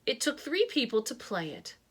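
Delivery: usual speaking voice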